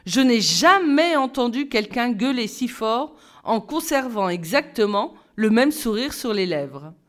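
plain reading voice

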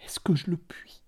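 an overwhelmed mother answering the man; stammered. A narrator's reading. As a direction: barely get the words out. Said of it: "Elle balbutia".

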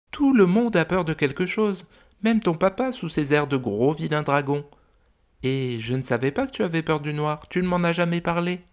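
high, gentle mother dragon voice; a fond, worried dragon mum. Acted. Mother dragon telling the visitors about her son, high gentle voice.

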